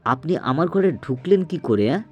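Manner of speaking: high, agitated challenge; alarmed demand. A narrator's reading. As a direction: demand an answer in shocked alarm. Suman alarmed at intruder in room.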